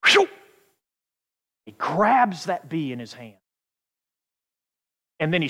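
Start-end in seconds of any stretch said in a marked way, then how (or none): 0.1-0.3 short, sharp whoosh; swift grab noise. Acted. Father snatches the bee, quick whooshing noise. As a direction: imitate a hand snatching a fly. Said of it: "the father goes".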